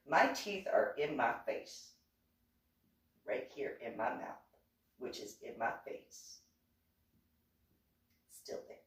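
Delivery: deadpan retort fading out to a whisper. Retold or imitated. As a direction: let the point dissolve into a hush. Her own retort trailing to whisper.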